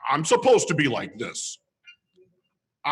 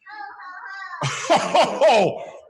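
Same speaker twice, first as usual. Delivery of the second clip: Santa laugh. Acted.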